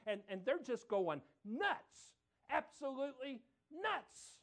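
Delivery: fervent preaching. Acted. Preacher building fervor to his congregation. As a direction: build the crowd up.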